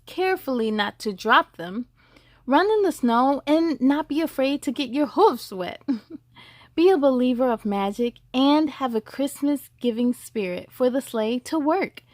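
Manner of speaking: ordinary delivery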